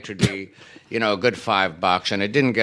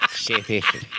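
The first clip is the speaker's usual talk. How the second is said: South African accent; 3 dB quieter than usual. Shown as a demonstration. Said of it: "That's my impression of a South African accent".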